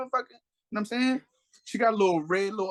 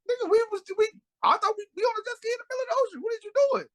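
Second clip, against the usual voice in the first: very high squeaky imitation of a woman protesting; mock outraged woman; faster than usual. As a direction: pitch it way up in frantic protest. Voices the woman panicking on jet ski.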